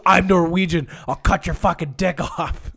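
loud, high mock rant; hammed-up hollered threat; imitated angry threat. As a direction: play an unhinged woman threatening kids. Imitates woman screaming threats at teens.

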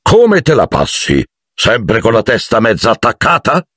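high, hearty teasing hail; stentorian greeting. A narrator's reading. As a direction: greet him with boisterous mockery. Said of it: "tuonò con voce stentorea".

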